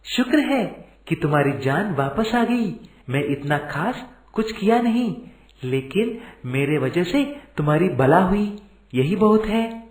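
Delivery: lifted, modest and relieved; humble Kamesh, relieved. Acted.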